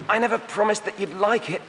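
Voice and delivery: put-on high young voice, croaked; croaking youth. A narrator's reading. Wim answers the Gruntons from the ground, croaking. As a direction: push it out through a dusty throat. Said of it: "he croaked".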